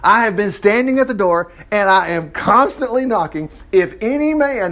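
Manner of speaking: high, insistent emphatic reading. Acted. Preacher voicing Jesus knocking at the door, emphatic. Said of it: "He's gotta put some emphasis on it".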